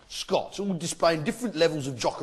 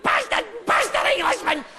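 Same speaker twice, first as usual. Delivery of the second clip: very high-pitched shouted yapping; yappy terrier Scotsman. Acted. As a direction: yap like an angry little Scottish terrier. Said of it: "your little yappy terrier jock".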